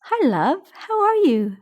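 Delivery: high, bright sing-out hello; chirpy mother-in-law picking up the call; cheerful greeting. A narrator's reading. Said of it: "She answered cheerfully".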